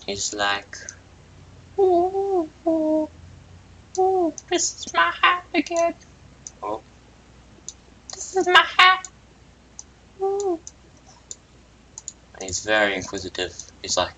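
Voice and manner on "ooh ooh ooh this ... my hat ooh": very high cartoon oohs; delighted cooing. Character cooing about his hat in a very high voice. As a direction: coo up high over the hat.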